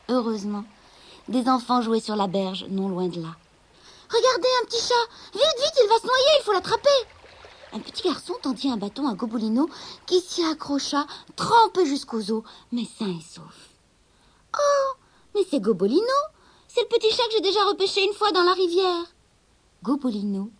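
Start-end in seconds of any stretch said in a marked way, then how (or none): 4.1-6.9 put-on much higher, loud urgent kid voice; hurry, point and shout; children calling from the riverbank; excited children. Children spot drowning kitten. 14.6-19.0 put-on much higher, loud delighted boy; boy recognising the kitten; surprised child.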